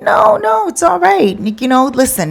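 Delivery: high-pitched no no, overly accommodating; high insistent manager. Retold or imitated. Manager politely refuses the customer's offer, high and fussy.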